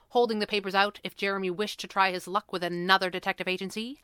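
ordinary delivery, nothing marked

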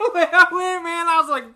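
high, loud laugh through the words; real laughter, loud and high. Real, not acted. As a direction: crack up while telling it. Laughing at own story.

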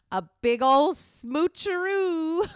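slow, high and loud teasing; playful tease. Retold or imitated. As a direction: stretch it out to tease him. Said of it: "And I was like".

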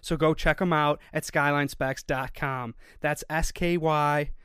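loud, polished podcast ad read; sponsor ad read. Real, not acted. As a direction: read the sponsor spot, projecting well. Reads sponsor ad.

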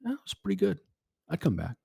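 lightly imitating wife. Wife replying after church service.

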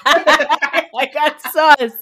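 very slow, loud, laughing. Real, not acted. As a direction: drag it out through laughter. Laughing agreement.

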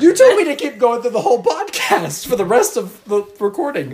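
high, loud, laughing complaint; laughing protest. Real, not acted. Co-host laughs at the reader's promise. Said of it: "forgot that I said that".

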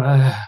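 low "ugh" of dismay; disgusted groan. Acted. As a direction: groan like a cringing Southerner. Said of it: "all Southerners just kind of, we all go".

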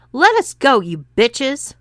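high, loud angry yell; a furious husband yelling at the women. A narrator's reading. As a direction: shout it at them to get free. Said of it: "yelled Bob".

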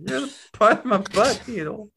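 high, teasing cartoon voice; high-voiced, cheeky sewing needle; high-pitched talking needle. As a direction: needle a rival in a high little voice. Needle teases Thread.

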